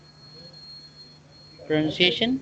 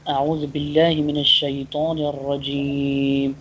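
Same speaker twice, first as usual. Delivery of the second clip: Arabic recitation; much faster than usual. Shown as a demonstration. Teacher recites Arabic formula.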